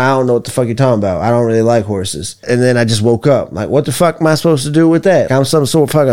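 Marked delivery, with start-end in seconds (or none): 0.0-2.4 rushed. Retold or imitated. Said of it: "I'm like".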